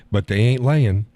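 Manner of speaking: ordinary talk, nothing marked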